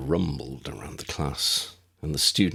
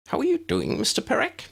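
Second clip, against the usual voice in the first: high, bright little wizard voice, kept up; a small, eager professor speaking; tiny professor voice. A narrator's reading. Flitwick asks the boy.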